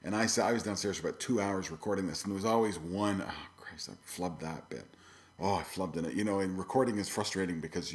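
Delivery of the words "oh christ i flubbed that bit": soft whispered annoyance; whispered frustration. Retold or imitated. Musician whispering to himself after flubbing a recording, frustrated. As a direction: breathe it out like you just flubbed the take. Recording, muttering over a mistake.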